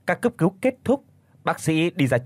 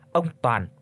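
ordinary delivery

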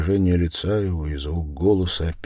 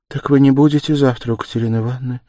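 quick, higher, anxious question; trembling voice; 7 semitones higher, 6 dB louder, faster than usual. A narrator's reading. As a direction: ask anxiously, voice about to falter. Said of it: "дрогнул голос у Сони". (с дрогнувшим голосом)

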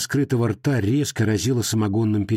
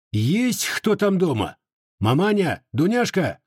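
calling out; 8 semitones higher than usual. Acted. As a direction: call out for family in the dark. Зовёт в тёмном доме. (зовёт в темноту)

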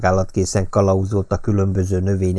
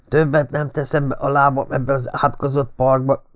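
hissed fury; 5 semitones higher than usual. A narrator's reading. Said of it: "sziszegte Mertens".

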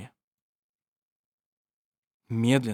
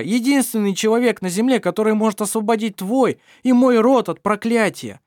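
heated plea; 8 semitones higher, 5 dB louder than usual. A narrator's reading. Daniel's heated plea to Mar.

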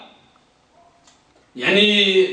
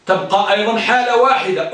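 ordinary delivery

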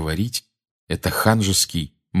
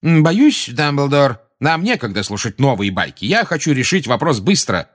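higher, insistent brush-off; pulling oneself together; 7 semitones higher, 4 dB louder than usual. A narrator's reading. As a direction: compose yourself and hurry the matter along. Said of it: "с видом человека, берущего себя в руки".

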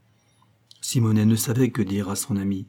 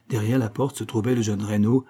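ordinary delivery, nothing marked

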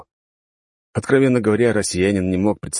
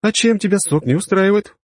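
rueful sigh; 14 semitones higher, 3 dB louder than usual. Acted. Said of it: "горестно вздохнула Виктория".

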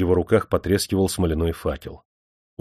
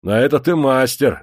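higher, louder defiant jab; 7 semitones higher, 6 dB louder than usual. A narrator's reading. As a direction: hit back without backing down. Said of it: "не унимался Кержак".

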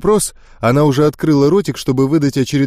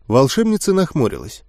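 plain reading voice

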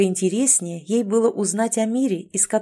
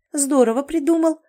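high, sarcastic praise; snide exclamation; 8 semitones higher, 3 dB louder than usual. A narrator's reading. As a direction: exclaim it in mock admiration. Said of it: "ехидно воскликнула девушка".

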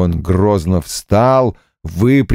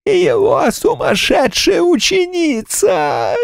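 ghost voice. Acted.

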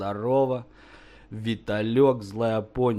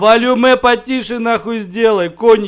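angry raised voice; 5 semitones higher, 5 dB louder than usual. Real, not acted. Demands the other lower his volume.

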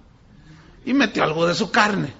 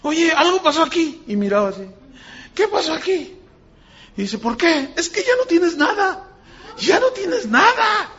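amazed doctor; 11 semitones higher than usual. Retold or imitated. Doctor amazed at vanished cysts.

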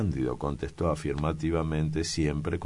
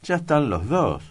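gloating; 11 semitones higher, 6 dB louder than usual. Acted. Priest gloating, rubbing his hands.

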